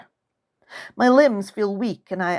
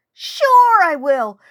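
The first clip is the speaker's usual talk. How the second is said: drawn-out reassurance; 9 semitones higher, 6 dB louder, much slower than usual. Acted.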